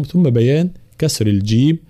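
ordinary talk

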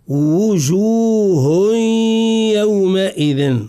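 وجوه very slow with rounded wu, humming into يومئذ; slow careful recitation, strong w in وجوه; full lip rounding. Shown as a demonstration. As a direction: draw out وجوه with full waw, then nasal glide into يومئذ. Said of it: "نضم الشفتين ضما كاملا".

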